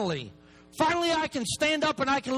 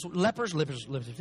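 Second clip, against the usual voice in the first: stuttering repeat; 6 semitones lower than usual. Real, not acted. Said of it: "if I stutter".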